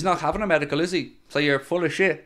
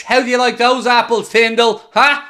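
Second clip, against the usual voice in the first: high, loud gloating jeer; sneering panto villain; 6 semitones higher, 6 dB louder than usual. Real, not acted. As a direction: rub the rival's nose in it. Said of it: "gloating at rival fan Tyndall".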